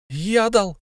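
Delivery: high, terrified squeak; frightened squeak. A narrator's reading. Bilbo squeaks in terror at Thorin. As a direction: squeak it out scared to death. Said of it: "пискнул до смерти перепуганный Бильбо".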